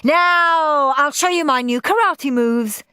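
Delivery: put-on loud, high-pitched child announcing; excited high boy voice; show-off boy. A narrator's reading. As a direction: launch in big on the first word, showing off. Henry announcing his next act.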